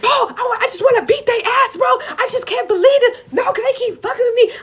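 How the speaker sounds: high-pitched imitation of a furious friend; worked-up friend throwing a scene; hammed-up angry yelling. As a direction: wail it out loud, ranting in mock outrage.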